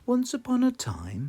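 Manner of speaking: high, storybook Mummy Pig voice; storytelling opening. Acted. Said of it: "Mummy Pig begins".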